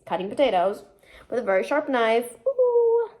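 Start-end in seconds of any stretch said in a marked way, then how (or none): 2.5-3.1 high, sustained wordless "ooh". Real, not acted. Waving a sharp knife while cooking, eerie high ooh. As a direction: let out a long high-pitched ooh.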